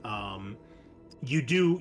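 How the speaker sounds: ordinary talk, nothing marked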